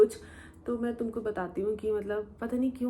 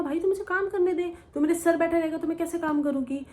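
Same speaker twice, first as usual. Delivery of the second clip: fed-up retort; 7 semitones higher than usual. Retold or imitated. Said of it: "तो मैं उसको बोलती थी".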